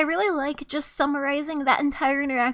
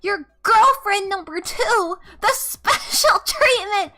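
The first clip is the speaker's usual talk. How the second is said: higher, emphatic mock outrage; retold indignant refusal; 6 semitones higher, 4 dB louder than usual. Telling boyfriend she's never saying it again, mock indignant. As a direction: punch out the refusal, drawing out the key words.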